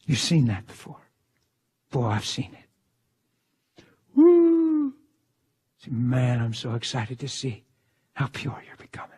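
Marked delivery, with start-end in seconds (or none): 4.1-4.9 long, high mock wail; imitated wail. Showing how people cry when encouraged, mock wail. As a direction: hold a high imitated crying note.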